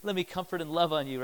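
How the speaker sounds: soft, hushed consoling; quiet imitation of motherly comfort. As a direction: soothe gently like a mom. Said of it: "your mother's always there".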